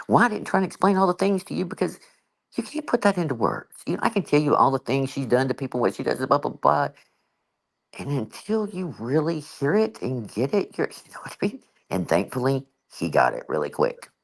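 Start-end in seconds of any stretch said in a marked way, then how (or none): none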